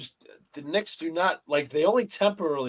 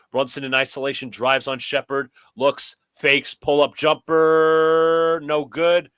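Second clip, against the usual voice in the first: brisk sportscaster calling the action; play-by-play commentary; 8 dB louder than usual. Pretend. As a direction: call it like a courtside announcer. Plays announcer over the game.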